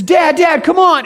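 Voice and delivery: put-on a five-year-old begging his dad; little boy tugging dad toward the coaster; excited kid calling. Excited child calls out.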